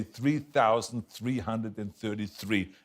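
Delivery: slow, careful TH in each "three" and "thirty"; TH diction drill. Shown as a demonstration. Pupil repeats coach's number drill, "three" with careful TH. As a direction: measure out "Three thousand", every TH distinct. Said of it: "So he had me say".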